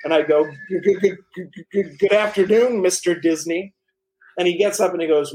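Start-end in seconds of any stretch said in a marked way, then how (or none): 0.7-3.7 mock-starstruck stutter on the G, rising higher; nervous stammer. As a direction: trip over the first word like a nervous kid meeting his idol. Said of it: "it was shaking a little".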